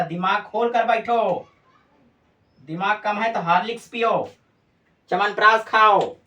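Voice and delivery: emphatic teacherly telling-off, ending loud; mock scolding. Real, not acted. Teacher telling pupils to wake their brains, mock scolding.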